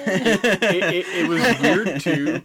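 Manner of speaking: giggling through the words; laughing mid-sentence; laughing while talking. Real, not acted.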